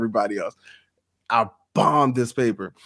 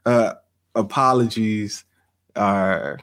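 usual speaking voice

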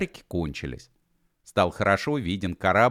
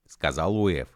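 plain reading voice

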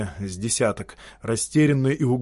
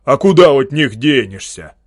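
loud bellowing outrage; a big peasant bellows his protest; roaring; 9 dB louder than usual. Acted. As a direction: roar at him, stamping. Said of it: "притопнув, заревел".

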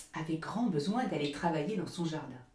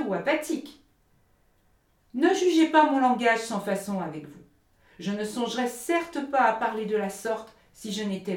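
loud, lofty sermonising miller; the miller scolding Hans for laziness, loud and preachy; lecturing miller; 4 semitones higher, 6 dB louder than usual. Acted. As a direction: preach at him with self-righteous force.

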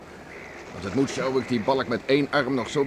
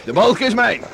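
higher, loud victory claim; triumphant claim; 9 semitones higher, 7 dB louder than usual. Acted. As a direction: shout out your win. Claiming the race win. (luid de winst opeisen)

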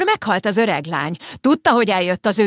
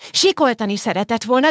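ordinary delivery

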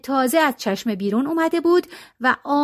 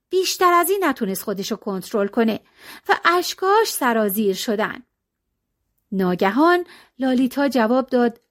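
plain reading voice

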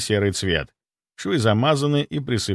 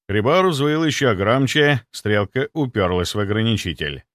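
ordinary delivery

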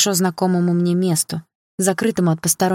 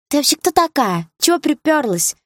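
high, indignant challenge; bratty schoolgirls' protest; 9 semitones higher, 3 dB louder than usual. A narrator's reading. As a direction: confront the intruder with outrage. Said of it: "возмутились её подружки".

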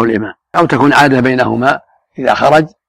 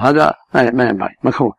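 ordinary delivery

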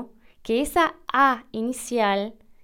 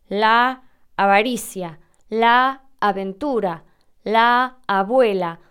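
la avaricia, la aventura, la abuela said slowly and louder; careful examples; 5 dB louder, slower than usual. Shown as a demonstration. Teacher lists examples for students, slow and deliberate. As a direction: set out each example slowly. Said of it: "como por ejemplo".

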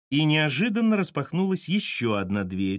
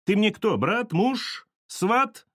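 high, rising questions; indignant challenge; 9 semitones higher, much slower than usual. A narrator's reading. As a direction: fire off scornful questions, climbing in pitch. Furious Sasha challenges Petka.

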